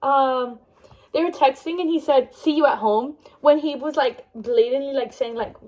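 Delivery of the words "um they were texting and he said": normal talking voice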